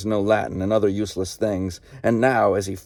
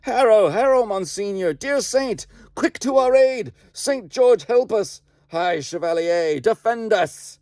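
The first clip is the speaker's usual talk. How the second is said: ghostly battle cry; 12 semitones higher than usual. Acted. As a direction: call the saint to your aid. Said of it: "heard the cry".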